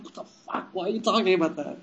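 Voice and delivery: hushed, quick mock exasperation; mock mad. Co-host objecting to statue's references, hushed mock anger. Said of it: "he'd be so mad".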